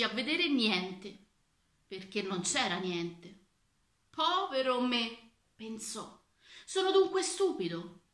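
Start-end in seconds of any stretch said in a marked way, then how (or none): 4.1-5.3 slow, drawn-out woe; dismayed lament. A narrator's reading. Minister laments to himself. 6.7-8.0 high-pitched, anxious question; alarmed self-doubt. A narrator's reading. The minister doubting his own wits, alarmed.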